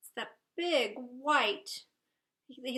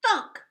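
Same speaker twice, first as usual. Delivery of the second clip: playful higher, louder "thunk"; thunk sound; 9 semitones higher, 9 dB louder than usual. Acted. Reading to kids, bird lands with a playful thunk. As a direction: pop out "thunk" like something landing.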